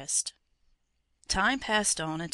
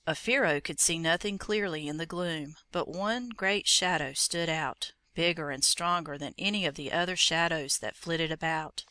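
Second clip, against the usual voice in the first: ordinary delivery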